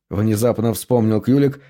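usual speaking voice

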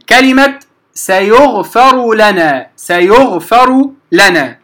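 slow demo of سيغفر لنا with the ra merged into the lam; slow Quranic demo of سيغفر لنا, ra merged into lam; idgham demo. Shown as a demonstration. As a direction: drawl سيغفر لنا so no ra is heard before the doubled lam. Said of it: "نضرب مثالًا لما يدغم".

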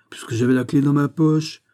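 mildly stubborn insistence; persistent. A narrator's reading. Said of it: "reprit Basque avec persévérance". (insistance obstinée, ton plus haut)

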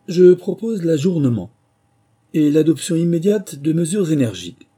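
loud, high pompous proclamation; emphatic. A narrator's reading. Dodo proposing measures to the assembly, loud and emphatic. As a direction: declaim it grandly. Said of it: "dit le dodo avec emphase".

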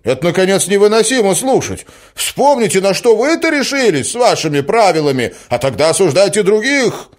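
high, loud, outraged retort; indignant cry. A narrator's reading. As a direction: fling the accusation back at her. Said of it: "вскричал он". (возмущённо, почти криком)

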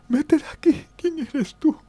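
put-on very high, wailing old woman voice; crying old lady. Grieving old mother shouting at the stranger at her door, crying high. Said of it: "Y ella lloraba".

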